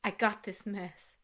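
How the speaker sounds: lightly voiced husband, slow and low; slow, softened mock-earnest quote; imitating the husband. Recapper quotes husband to his wife, lightly imitating him. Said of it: "This is him".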